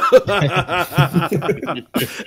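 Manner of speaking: big, loud burst of laughing; real belly laugh; loud laughter. Real, not acted. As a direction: crack up loudly for a couple of seconds.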